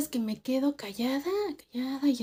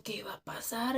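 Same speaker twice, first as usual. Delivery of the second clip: nervous whispered question; frightened girl whispering back in the dark; 9 dB quieter than usual. Retold or imitated. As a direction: whisper it, scared to be heard. She asks quietly, scared.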